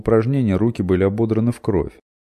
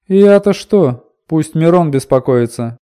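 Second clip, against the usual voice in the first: indignant woman; 10 semitones higher, 10 dB louder, slower than usual. Acted. Woman snaps back.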